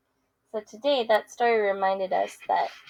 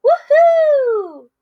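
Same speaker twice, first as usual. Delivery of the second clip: much higher, loud whoop of victory; triumphant cheer; 12 dB louder than usual. Acted. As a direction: whoop like you just won. Pig cheers his throw.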